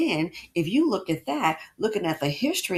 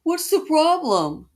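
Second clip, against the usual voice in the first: higher, louder and slow; indignant challenge; 8 semitones higher, 6 dB louder, slower than usual. Real, not acted. Host challenging critics, slow indignant question. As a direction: demand an answer.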